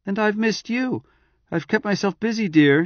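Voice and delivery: high, quick chatty wife voice; chattering wife. Acted. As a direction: rattle on brightly. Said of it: "she chattered".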